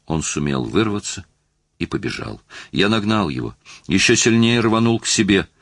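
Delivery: usual speaking voice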